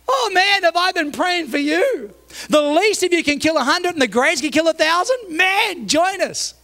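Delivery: high, gushing mock excitement; over-eager welcome. Preacher playing David greeting newcomers, over-eager. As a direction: greet them like long-awaited heroes, drawn out.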